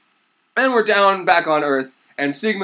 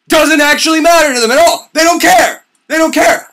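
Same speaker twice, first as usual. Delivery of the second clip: loud, high comic outrage; exasperated yell; 10 semitones higher, 9 dB louder than usual. Real, not acted. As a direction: yell it in disbelief.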